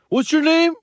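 put-on high-pitched drunk fan asking; mock drunk questioner; drunk fan impression. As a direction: imitate a tipsy fan, pitched right up. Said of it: "They were kinda drunk".

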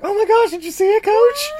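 put-on high, fast squealing girl; excited girl.

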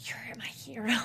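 soft breathless whisper of admiration; awed whisper. Retold or imitated. The boy telling John he's his hero, whispered. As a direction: whisper it like a starstruck kid.

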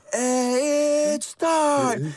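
high, drawn-out ring announcer call; Bruce Buffer. Retold or imitated. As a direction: hold each word long like a fight announcer. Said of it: "Bruce Buffer is die guy van".

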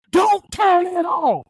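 high, slow shouted demand; retold shout. Retold patient shouting at therapist, slow high shout. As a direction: shout it, dragging every word.

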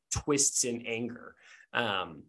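usual speaking voice

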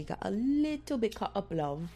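a hammed-up fake British voice, barely held; horrible British accent. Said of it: "I'm aware I have a horrible accent".

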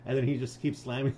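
ordinary talk, nothing marked

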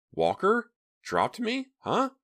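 very high baffled questions; stunned disbelief. A narrator's reading. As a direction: ask it totally bewildered.

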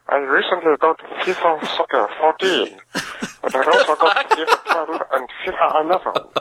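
cartoon European villain accent, hammed up; generic European villain accent. Caller talking soccer games to the podcast, European villain accent. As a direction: put on a vague Transylvanian-French accent. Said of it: "generic Hanna-Barbera European villain".

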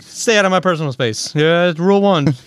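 interdimensional cable bit. Retold or imitated. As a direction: bark the rules like a cable show host. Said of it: "a Rick and Morty thing".

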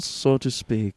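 usual speaking voice

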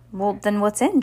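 plain reading voice

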